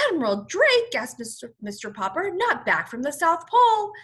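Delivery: high, loud astonished gasp; gasp of surprise. Acted. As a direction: blurt it out in amazement. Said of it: "gasped Mister Popper".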